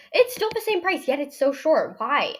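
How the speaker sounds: high-pitched, mildly outraged protest; indignant complaint. Retold or imitated. Player complaining about the shortened soccer season, indignant. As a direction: protest the unfairness, exasperated.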